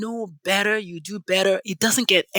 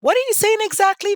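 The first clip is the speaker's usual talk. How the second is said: much higher, louder disbelief; incredulous; 13 semitones higher, 4 dB louder, faster than usual. Real, not acted. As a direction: squeal it in disbelief. Incredulous reaction.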